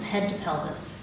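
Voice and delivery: ordinary delivery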